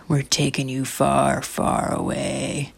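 slow, stretched last words; drawn-out threat. Acted. Mom to the bug she is removing, slow mock warning. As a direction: draw out the distance.

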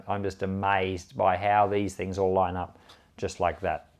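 normal talking voice

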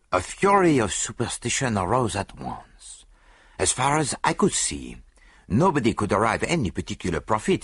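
put-on foreign-accented detective, assured and deliberate; measured accented exposition; Poirot, explaining the case. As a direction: lecture with relish as the great detective.

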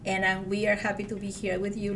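ordinary talk, nothing marked

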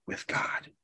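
breathy hush on the key words; tender whispered close. Real, not acted. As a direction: drop to a whisper to draw them in. Sermon climax, hushed.

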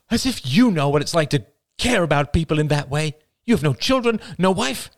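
high, loud hurt retort; wounded mother. Acted. Mother lashing back at her son, high and hurt. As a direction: lash back at him.

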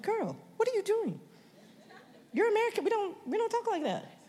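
high, laughing self-scolding; mock-scold yourself for going British, pitched high; scolding herself. Preacher scolding herself for her new British words, laughing. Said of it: "I'm like".

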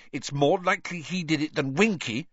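high, insistent rebuttal; stubborn. A narrator's reading. Hermione sticking up for Winky, stubbornly. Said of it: "said Hermione stubbornly".

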